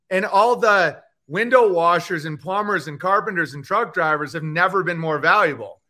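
ordinary delivery

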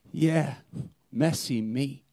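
ordinary delivery

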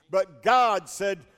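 usual speaking voice

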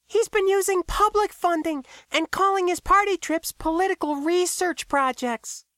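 very high-pitched character voice; KB informing. A narrator's reading. KB tells Max of the mayor's spending, high-pitched. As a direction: report the scandal in a high voice.

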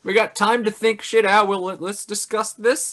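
mock human response. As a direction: play humanity nervously buying time. Mock human reply to aliens.

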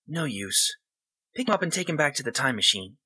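put-on a kid giving quick orders; lightly voiced boy, fast; boy's voice. Boy character gives orders.

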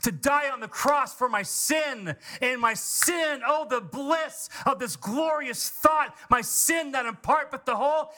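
high-pitched, driving sermon delivery; fervent preaching. Acted. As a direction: preach it with mounting fervour into impassioned prayer. Preaches at full force.

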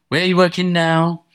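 a touch of a weary mum; tired, doubtful question. Retold or imitated. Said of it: "she sounds a little weary".

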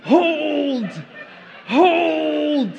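a pastor hams up Leonidas rallying his shield wall, shouting; Leonidas battle shout. Retold or imitated. Mock war-cry orders to hold the line. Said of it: "in that dope accent".

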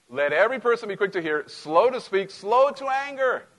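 ringing preacher read, high then slowing; loud pulpit scripture reading. Acted. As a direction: proclaim the verse to the congregation. Reads scripture aloud.

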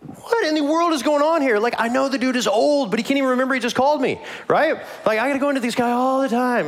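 high-pitched exasperated rant; frustrated. Acted. Boy grumbles about the old man who keeps calling, frustrated. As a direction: complain in a high, incredulous voice. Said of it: "they're both really frustrated".

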